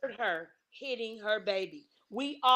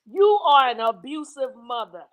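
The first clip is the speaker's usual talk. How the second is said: loud accusation; 10 dB louder than usual. Real, not acted. Live online argument, accusing.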